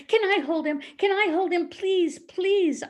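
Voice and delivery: high, loud, eager pleading; imitating a child begging Daddy. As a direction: plead like an excited little kid. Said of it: "I would beg".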